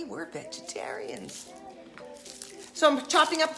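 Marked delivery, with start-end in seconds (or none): 0.0-1.5 unvoiced whispered aside; hushed confession. Real, not acted. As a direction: whisper it like a secret.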